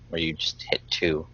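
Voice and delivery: unvoiced whisper; whispered aside. Real, not acted. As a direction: whisper it under your breath. Whispered aside about dungeon.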